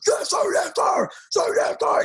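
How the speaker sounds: high shouted yes sir, like a boot camp recruit; barked military recruit reply. Retold or imitated. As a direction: bark it out like a nervous private. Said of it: "like Private Snowball from Full Metal Jacket".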